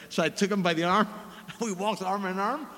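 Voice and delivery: ordinary talk, nothing marked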